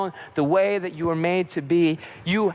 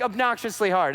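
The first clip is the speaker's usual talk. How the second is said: ordinary delivery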